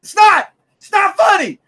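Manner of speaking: loud, high, spitting rant; heated yell. Real, not acted. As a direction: shout until you spit. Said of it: "You're spitting on".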